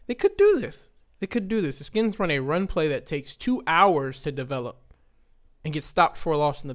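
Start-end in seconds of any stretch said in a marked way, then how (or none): 0.1-0.9 "do" jumps way up, thrilled; excited disbelief. Retold or imitated. Retelling the late drive to viewers, suddenly thrilled and high. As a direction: voice leaps high on "they could do this".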